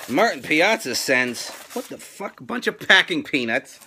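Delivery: ordinary delivery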